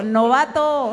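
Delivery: un "Novato" gritado, más fuerte; gríta "Novato" desde el público; grito burlón. Retold or imitated. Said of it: "alguien allá te gritó". (grito burlón del público)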